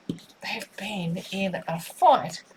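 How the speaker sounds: slow, dramatic whisper; hushed whisper. Real, not acted. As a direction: lean in and whisper it slowly. Dramatic aside about the lovers.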